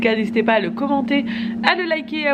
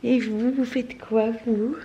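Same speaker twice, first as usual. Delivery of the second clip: put-on baby voice; 4 semitones higher than usual. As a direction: talk for the baby as if it just woke up. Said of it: "Regardez qui vient de se réveiller".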